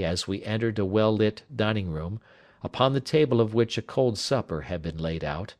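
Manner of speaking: plain reading voice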